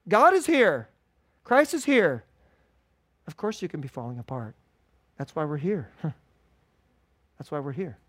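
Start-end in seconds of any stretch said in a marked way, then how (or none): 3.3-8.0 much softer and lower, reassuring; tender aside. Real, not acted. Pastor reassures the hurting in church, softly. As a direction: hush down and comfort them.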